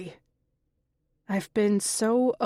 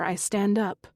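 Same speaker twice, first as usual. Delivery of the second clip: ordinary delivery, nothing marked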